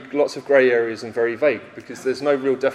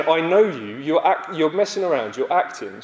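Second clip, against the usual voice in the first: usual speaking voice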